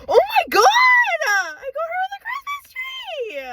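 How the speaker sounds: high shrieking squeal of joy; excited squeal. Real, not acted. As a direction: scream with delight at the prize. Squealing at getting the wanted figure.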